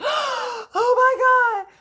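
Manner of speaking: gasp, then very high drawn-out squeal; hammed-up, loud, slow fangirl shriek; overjoyed. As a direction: imitate a giddy eight-year-old thrilled by a love note. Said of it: "happier than a pig in mud".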